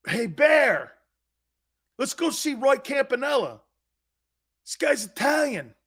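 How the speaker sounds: high-pitched eager invitation; imitated excited urging. Friends talk grandfather into seeing Campanella, excited urging.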